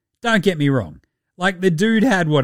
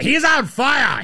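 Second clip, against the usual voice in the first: high, shouted catchphrase; hyped commentator call; 9 semitones higher than usual. Acted. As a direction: call it out like a game announcer. Hyped sports-call catchphrase for player.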